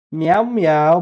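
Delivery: high, loud playful meows at the cat; mock meowing. Real, not acted. Talking to his cat, high playful meows. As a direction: meow back at the kitty.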